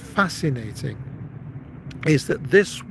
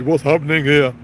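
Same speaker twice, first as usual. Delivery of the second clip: big guy's booming voice, louder; big-voiced man; 8 dB louder than usual. Big husband comes to the door, loud big voice. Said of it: "the big voice".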